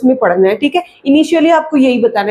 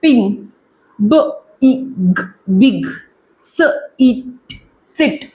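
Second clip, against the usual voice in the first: slow phonics blending; much slower than usual. Shown as a demonstration. Teacher blending बिग and सिट slowly for parents. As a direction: sound by sound, very slow. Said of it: "जैसे कि".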